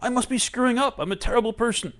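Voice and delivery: high-pitched acted alarm, loud at first; panicked self-blame. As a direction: wail it in mock distress. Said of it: "right now what you're saying is".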